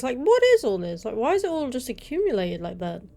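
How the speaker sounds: plain reading voice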